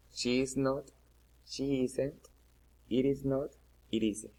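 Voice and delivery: normal talking voice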